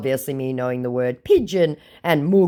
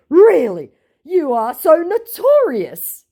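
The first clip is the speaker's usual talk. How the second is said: mock scammer voice; 8 dB louder, slower than usual.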